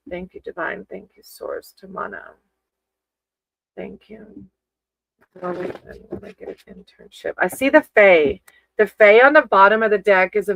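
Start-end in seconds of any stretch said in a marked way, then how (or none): 0.1-7.3 softer, fading to a near whisper; prayer murmured ever softer until whispered; hushed prayer. Acted. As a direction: murmur the last thanks under your breath. Closing prayer trailing into murmur.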